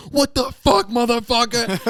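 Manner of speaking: high, loud yell at a passing car; furious teen shout; teenage yell. Retold or imitated. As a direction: scream it after the car. Teen yells at SUV driver.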